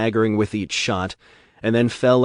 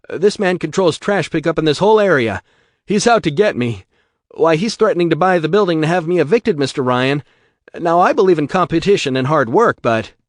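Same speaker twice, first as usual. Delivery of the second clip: desperate pleading; 8 semitones higher, 8 dB louder than usual. Acted. Gravenstein pleads, upset.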